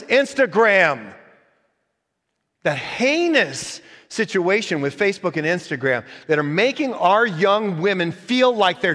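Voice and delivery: loud, high, pounding sermon delivery; full-force preaching. Acted. Pastor warning the church about what goes in, full-force preaching. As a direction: drive it home to the congregation.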